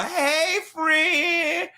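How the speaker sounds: mock her passive-aggressive sweetness; high, slow fake-friendly hello; fake-sweet Carla. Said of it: "nasty Facebook message from Carla".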